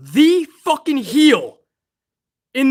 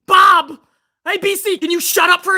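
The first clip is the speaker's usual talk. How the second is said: loud, higher exasperated yell of the name; exasperated shout; 6 semitones higher, 3 dB louder than usual. Real, not acted. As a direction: throw the name out, fed up. Ranting at chat.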